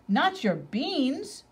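outraged cry. A narrator's reading. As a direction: protest in mock outrage. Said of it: "cried Skipito, outraged".

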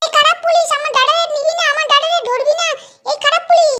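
put-on high child voice scolding the police; a small boy telling off the bad cop; angry little kid. Little brother shouting at the police not to take his brother, high and cross.

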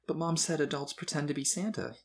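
shaken. A narrator's reading. Boy repeats mom's comments to dad, shaken. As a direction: doubt it, a little upset. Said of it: "still shaken by his mom's comments".

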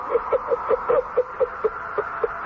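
a ghostly unvoiced cackle from somewhere unseen; airy, distant laugh; eerie breathy laugh. Unseen presence laughing at the frightened widow, eerie. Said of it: "terrible laughing".

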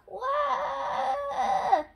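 high, loud, sustained mock wail; loud wailing cry. A narrator's reading. George cries over his lost balloon, loud wail. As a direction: bawl like a small child in a storybook. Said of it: "cries George".

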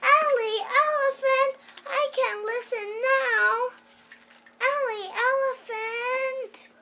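put-on mother calling her child. A narrator's reading. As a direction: call her name from far off. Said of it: "she heard calling".

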